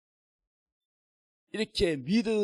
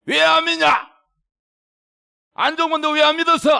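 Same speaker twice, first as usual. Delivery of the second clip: high, loud angry scolding; angry rebuke; 10 semitones higher, 9 dB louder than usual. Acted. Risen Jesus scolding unbelieving disciples, loud angry rebuke. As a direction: raise your voice in angry reproach. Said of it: "화를 냈어요".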